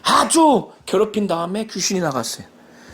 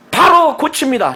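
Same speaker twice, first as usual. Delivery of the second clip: normal talking voice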